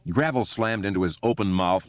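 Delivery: plain reading voice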